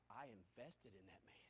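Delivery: soft and low confiding; quiet personal confession; hushed. Real, not acted. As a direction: drop to a near hush.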